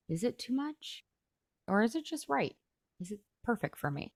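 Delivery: soft, lilting inner questions; questioning self-talk. Acted. Coach models questioning a negative thought, softly wondering. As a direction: quietly wonder it to yourself.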